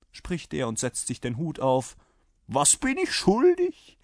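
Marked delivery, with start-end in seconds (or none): 2.5-4.0 high, loud, slow question; brash rider. A narrator's reading. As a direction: ask for the bill boldly. Rider asks for the bill. (forscher Reiter, hoch und laut)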